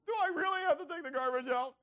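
put-on loud, high-pitched protesting kid; high, insistent whine; whining child. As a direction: plead loudly like a put-upon child. Child comes back whining, asking again.

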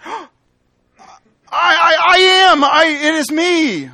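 high, loud, drawn-out emphatic cry; emphatic exclamation. Shown as a demonstration. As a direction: call out to identify yourself, stretching the words. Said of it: "an emphatic expression at times".

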